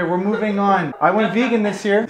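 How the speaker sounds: ordinary delivery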